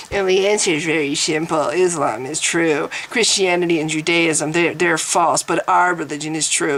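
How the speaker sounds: low, pompous mock pronouncement; pompous arrogant twit. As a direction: imitate a smug know-it-all handing down the truth. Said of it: "this pompous, arrogant twit who sits there and goes".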